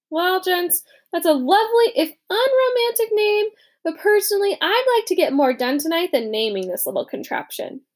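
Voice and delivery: a wry brush-off of the new name; Ezra, dry. Acted. Ezra mocks the unromantic name to the others, dry and wry.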